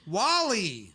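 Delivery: drawn-out, high call of the name. Real, not acted. Co-host hails the dead TV star, calling out. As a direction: call out Wally with a laugh.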